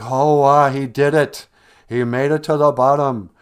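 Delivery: loud, rising excitement; joyful amazement. Acted. The animals discover the earth, loud and overjoyed. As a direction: shout it out as the news sinks in. They find earth in paw.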